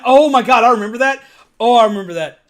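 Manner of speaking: excited recall. Real, not acted. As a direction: blurt it out as it hits you. Sudden memory.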